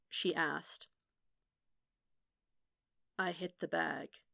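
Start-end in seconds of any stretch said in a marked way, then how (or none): 3.2-4.2 soft, toneless repetition in dawning horror; hollow horror. A narrator's reading. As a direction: repeat it quietly, drained of inflection. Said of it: "sound of horror in Matt's voice".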